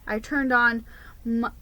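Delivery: plain reading voice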